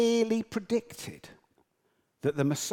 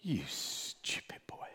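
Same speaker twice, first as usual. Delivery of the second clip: lower, softer, slow exasperated officer; a weary commander scolding his young private; Captain Mainwaring; 10 semitones lower, 12 dB quieter, slower than usual. Retold or imitated. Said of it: "in the voice of Captain Mainwaring".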